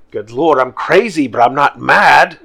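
loud, frustrated exclaim; exasperated outburst. Acted. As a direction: blow up in disbelief.

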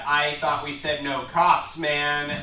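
a crook scolding his guards for letting a cop in; annoyed gang boss. Acted. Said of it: "the handsome man looks at the orcs and he said".

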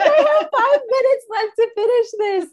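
frantic over the deadline; panicked. Retold or imitated. Mother rushing to finish a grant application, panicked.

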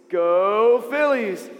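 loud, long drawn-out "Go"; crowd cheer. Shown as a demonstration. Storyteller teaches kids the Philistines' cue, cheering. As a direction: cheer like a fan in the stands. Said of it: "people cheering for them".

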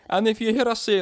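amateur Scottish lilt; mock Scottish accent. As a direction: try a shaky-grasp Scottish accent. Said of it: "we're gonna be trying this accent".

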